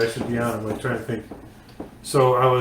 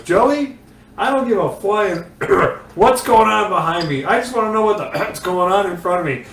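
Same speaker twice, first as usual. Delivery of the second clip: raised, higher imitating an irritated driver; mock exasperated radio outburst, pitched up; emphatic rant; 7 semitones higher, 4 dB louder than usual. Retold driver yelling at his spotter over the radio, exasperated. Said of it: "Dale Junior was very emphatic".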